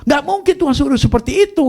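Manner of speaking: high-pitched, indignant refusal; retold outburst of angry disbelief; imitated scolding. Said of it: "Nah, dimaki-maki saya sama cici saya".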